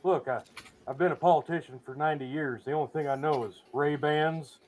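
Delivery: put-on low-key Southern-accented president; strange Southern-tinged accent. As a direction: play the old politician with a Southern accent. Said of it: "suddenly has a very strange accent".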